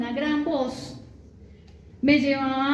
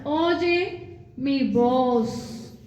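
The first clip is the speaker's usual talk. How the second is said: slow pleading; much slower than usual. Acted. God's voice pleading to listen.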